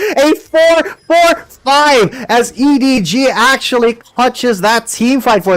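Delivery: high, loud hype call; shouting caster. Real, not acted. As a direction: scream the clutch play. Caster shouting clutch play.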